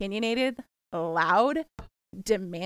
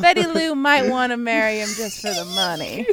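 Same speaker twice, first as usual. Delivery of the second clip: put-on Southern accent; 5 dB louder than usual. Southern character voice.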